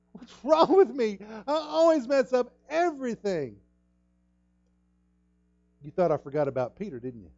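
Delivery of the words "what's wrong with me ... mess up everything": put-on high, loud whining wail of a child; child's despairing cry. Preacher imitating a child's outburst, high whining wail. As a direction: cry it like a kid who failed again. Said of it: "heard their kids make a statement like that".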